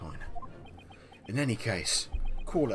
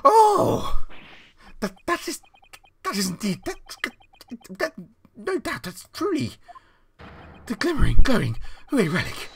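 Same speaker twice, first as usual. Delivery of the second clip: put-on high, loud, stammering old man; excited old man; 11 semitones higher, 11 dB louder than usual. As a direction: sputter with astonished glee. Old owner recognizes lost coin.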